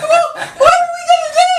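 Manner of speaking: high, loud, laughing-crying; mock panicked wail. Wife wailing to husband about the ram, high and teary. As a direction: wail it in comic despair.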